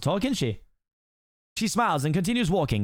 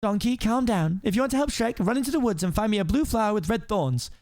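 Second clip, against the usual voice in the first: high princess voice, taking charge; firm princess; 7 semitones higher than usual. Acted. Princess sending Donkey on an errand, firm and high. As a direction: give clear orders in a raised voice.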